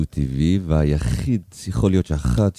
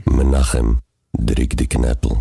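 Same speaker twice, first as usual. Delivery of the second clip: louder, lower Yiddish-accented call of דריק די קנפל; a full-voice Yiddish-accented promo for listeners; 6 semitones lower, 3 dB louder than usual. Shown as a demonstration. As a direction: belt the jingle line in a Yiddish accent. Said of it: "תעשה את זה רגע".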